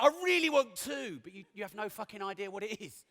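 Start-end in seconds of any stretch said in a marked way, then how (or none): 0.0-1.2 high mock plea; whiny audience voice. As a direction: whine it like a hopeful kid. Mocking audience wish.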